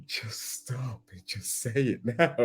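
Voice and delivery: hushed urging whisper. Pretend. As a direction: whisper it like a plea to come clean. Urging lying teen to confess.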